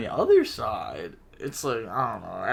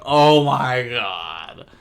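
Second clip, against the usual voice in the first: exasperated groan; 5 dB louder, much slower than usual. Real, not acted. Exasperated at the ridiculous call.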